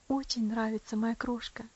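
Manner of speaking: plain reading voice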